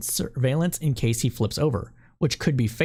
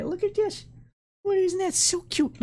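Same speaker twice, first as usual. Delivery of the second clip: much higher, cooing over a cute pet; cooing baby talk. Acted. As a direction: gush like showing off a baby animal. Cooing over frog picture.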